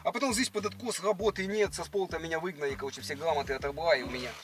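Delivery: ordinary talk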